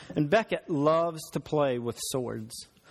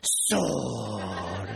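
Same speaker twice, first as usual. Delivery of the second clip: low, softer, long drawn-out mock battle cry; Beckett drawing his sword; 8 semitones lower, 4 dB quieter than usual. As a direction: stretch it out low, imitating a playful kid. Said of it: "And then he goes".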